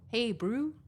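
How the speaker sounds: Hey, brew with a Kiwi bro vowel; New Zealand greeting; Kiwi accent. Retold or imitated. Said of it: "a Kiwi will say".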